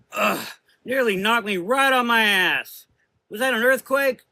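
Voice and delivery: yelling after being knocked about; shaken shout. A narrator's reading. Rose shaken by the blast, shouting.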